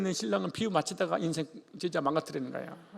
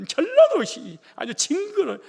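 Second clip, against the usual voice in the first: exasperated outburst; 11 semitones higher, 9 dB louder, slower than usual. Real, not acted. Exasperated outburst about Jeolla.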